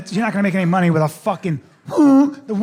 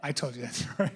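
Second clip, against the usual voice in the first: hushed, low aside; discreet objection; 8 semitones lower, 15 dB quieter than usual. Retold or imitated. Friend quietly claims his stolen story, low hushed voice. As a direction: murmur it privately to your friend. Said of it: "And he goes".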